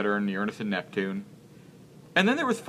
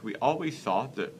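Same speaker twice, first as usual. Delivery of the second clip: ordinary talk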